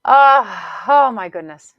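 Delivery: loud, lifted oh my goodness; delighted exclamation. Real, not acted. Coach gushing to listeners, loud delighted. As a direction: gush with delight.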